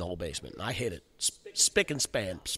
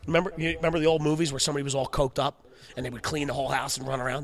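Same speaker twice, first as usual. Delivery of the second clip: ordinary delivery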